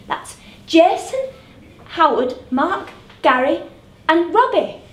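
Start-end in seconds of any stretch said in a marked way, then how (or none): none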